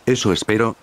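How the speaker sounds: ordinary delivery, nothing marked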